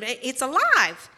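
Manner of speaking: half-scream it in acted shock; screamed alarm. Sister telling Mother the doll moves, mock screaming. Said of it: "And she screamed".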